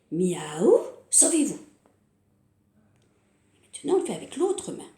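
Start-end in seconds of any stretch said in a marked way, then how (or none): 0.1-1.6 sudden loud miaou; loud cat meow. Shown as a demonstration. Cat meows, chicks flee.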